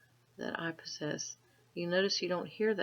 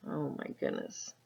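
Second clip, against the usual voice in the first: ordinary delivery, nothing marked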